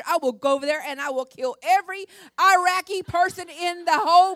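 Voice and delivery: loud, high, tearful raging yell; furious threat. Retold or imitated. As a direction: roar the threat like a mama bear. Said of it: "Oh, I was so mad".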